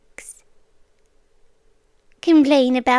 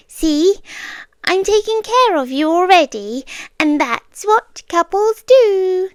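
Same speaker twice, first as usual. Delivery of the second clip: louder, higher, cheery and pleased; bright insistence; 5 semitones higher, 4 dB louder than usual. Acted. Captor serving tea to captive, cheery and pleased. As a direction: sell it brightly, beaming.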